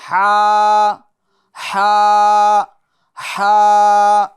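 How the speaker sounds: ہا pushed from the middle of the throat, loud and held; loud throaty haa. Shown as a demonstration. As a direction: breathe ہا out hard from mid-throat. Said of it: "اس کو درمیان حلق سے ادا کرنا ہے". (ہا کو درمیان حلق سے زور سے ادا کریں)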